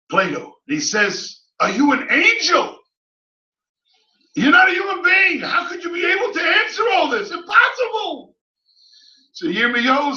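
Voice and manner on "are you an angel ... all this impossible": much higher, disbelieving exclamations; stunned, can't believe a human knows this; astounded philosopher. Acted. Astounded Plato.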